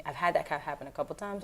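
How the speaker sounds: ordinary talk, nothing marked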